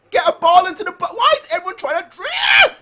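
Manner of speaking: high, loud frustrated shout; exasperated yelling. Real, not acted. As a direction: scream it in disbelief at the team. Fan yelling at players on TV.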